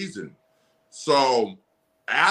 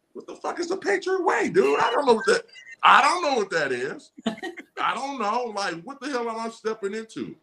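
bewildered outburst; 8 semitones higher than usual. Retold or imitated. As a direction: play him thrown by a strange new team. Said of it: "I'm like".

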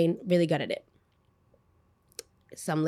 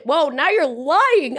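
high, drawn-out disbelief; retold outraged call-out; indignant accusation; 8 semitones higher, 4 dB louder, slower than usual. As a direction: stretch it out, slow and pitched up. Acted quarrel over false accusation.

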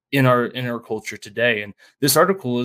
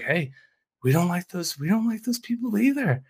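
sweet, higher-pitched coaxing recruiter; nice coaxing; 6 semitones higher than usual. Retold or imitated. Extremists luring a disaffected voter, sweet coaxing. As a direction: lure them in gently, voice lifted high. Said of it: "it's this nice coaxing".

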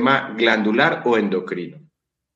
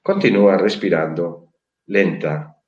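guided meditation. Acted. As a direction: lull them into calm, slowly.

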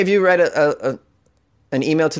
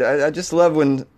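normal talking voice

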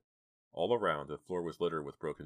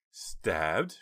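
shrieking; 3 semitones higher, 6 dB louder than usual. A narrator's reading. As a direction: cry it out in shock. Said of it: "shrieked Jade".

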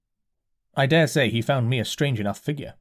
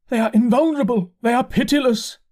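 dazed curate; 12 semitones higher, 5 dB louder than usual. A narrator's reading. Curate voiced by narrator.

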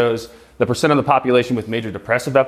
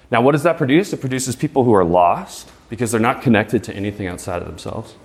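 ordinary talk, nothing marked

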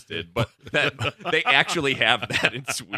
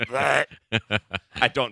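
ordinary talk

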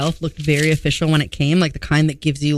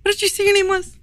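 much higher, put-on woman's voice; wife voice. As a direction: pretend to be the wife cutting in. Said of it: "my wife's on the phone".

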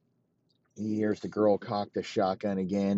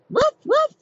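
two high, loud imitated barks; dog barks; 7 dB louder than usual. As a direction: bark twice like a small dog. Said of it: "And the dog barks".

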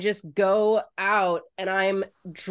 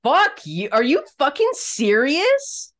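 retold angry retort; 6 semitones higher, 4 dB louder than usual. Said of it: "made me so mad".

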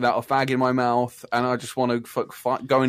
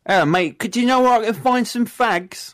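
higher mock English accent; 8 semitones higher than usual. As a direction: play the Brit asking for cigarettes. Imitating English shopper at Kmart.

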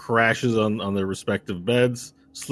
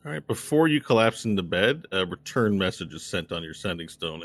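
ordinary talk, nothing marked

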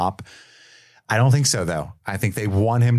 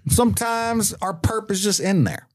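higher-pitched mock cop pep talk; cop mentor; 8 semitones higher than usual. Kevin advising Jay on police ways, mock mentor. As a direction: explain the gym like a cocky senior cop. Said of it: "That's what he's telling Jay".

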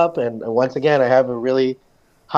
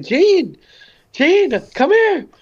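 calling out; much slower than usual. Retold or imitated. Woman calling Jean over.

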